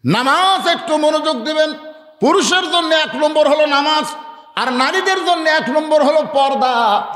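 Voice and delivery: high, ringing preacher's delivery; high sermon cadence; declamatory preaching. Real, not acted. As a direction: proclaim each rule to the congregation. Preaching at full force.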